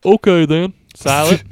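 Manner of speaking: put-on Southern accent. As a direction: talk like a mock Southerner. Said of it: "gonna do a southern one".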